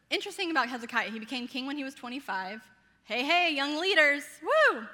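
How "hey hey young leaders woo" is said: loud, high shout-out ending in a whoop; rally the crowd with a big woo; whooping cheer. Real, not acted. Teacher hyping the young leaders listening, whooping cheer.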